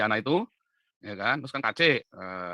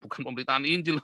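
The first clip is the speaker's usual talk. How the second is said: ordinary talk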